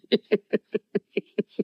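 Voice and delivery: high rippling chuckle; a long, high run of chuckles; laughing. Real, not acted. Speaker laughs after saying his anger is mercy, amused chuckle.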